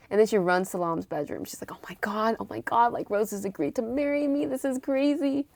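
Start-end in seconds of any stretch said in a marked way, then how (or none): 1.7-5.5 giddy, higher-pitched retelling; overjoyed. Retold or imitated. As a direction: gush over thrilling news, stretching the last words. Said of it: "so happy".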